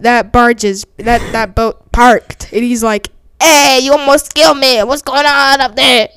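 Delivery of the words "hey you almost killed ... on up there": loud, higher cartoon indignant yell; Sheen from Jimmy Neutron. Danny yells up at boat deck, indignant cartoon shout. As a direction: call up in an excitable cartoon voice. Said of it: "I sound like Sheen from Jimmy Neutron".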